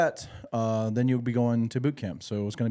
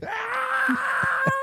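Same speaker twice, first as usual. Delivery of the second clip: piercing high shriek imitation; pterodactyl screech. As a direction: screech like a prehistoric bird. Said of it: "like, pterodactyl screech".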